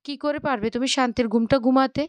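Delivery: plain reading voice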